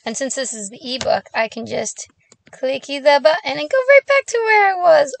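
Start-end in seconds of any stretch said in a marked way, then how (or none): 2.5-5.2 high, loud and playful; playful show-off. Real, not acted. Author showing viewers a handy ebook link, playful and loud. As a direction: raise the voice gleefully as you demonstrate.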